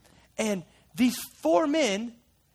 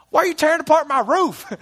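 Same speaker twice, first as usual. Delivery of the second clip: higher, louder, a hammed-up homeowner's complaint; mock indignant Jesus; 7 semitones higher, 8 dB louder than usual. Joking Jesus scolds the men on his roof, mock outrage. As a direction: playfully grumble at the roof wreckers. Joke quote, then "he didn't say that.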